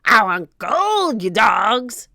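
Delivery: slow, loud pirate demand; greedy, bullying pirate roar; pirate captain. A narrator's reading. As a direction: bellow it slowly at the crew.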